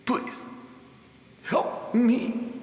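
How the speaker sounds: weak whisper. Acted. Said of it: "you manage to whisper".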